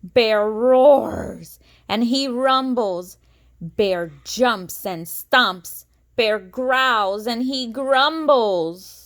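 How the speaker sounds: slow roaring storybook read; building bear rage. A narrator's reading. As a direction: boom it out like an angry bear. Bear wakes angry.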